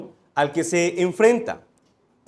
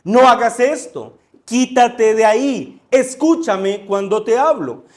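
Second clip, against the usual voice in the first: raised, urgent voice of a parent giving orders; acted warning shout; 3 semitones higher, 4 dB louder than usual. Father warning his child away from danger, raised urgent shout. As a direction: shout a firm warning, moderately louder than preaching. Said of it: "eleve el tono de su voz y le grite".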